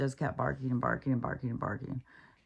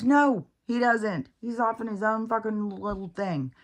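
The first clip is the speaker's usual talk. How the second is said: exasperated reply; 5 semitones higher, 4 dB louder than usual. Retold or imitated. To people who say Max won't listen, exasperated. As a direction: drag out the no, worn out. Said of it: "It's like".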